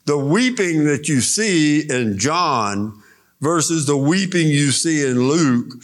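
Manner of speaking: ordinary delivery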